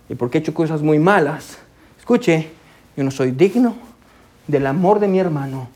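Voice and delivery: low, heavy, ashamed inner voice; guilty inner voice. Retold or imitated. A brother telling himself he is unworthy of forgiveness, in a low, ashamed voice. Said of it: "Ellos pensaban".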